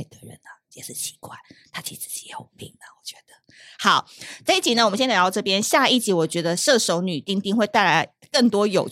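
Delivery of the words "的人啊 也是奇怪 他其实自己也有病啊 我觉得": voiceless whisper behind a hand; a hushed gossip whisper; whispered aside. Real, not acted. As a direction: let the listeners in on a secret. Gossipy aside at episode end.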